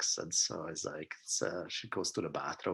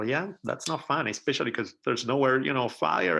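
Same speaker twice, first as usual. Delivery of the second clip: usual speaking voice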